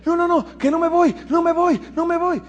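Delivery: high, frantic no, no, no; panicked refusal. Retold or imitated. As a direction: beg not to go, in a panic. Paciente en pánico se niega. (negativa aterrada, aguda y desesperada)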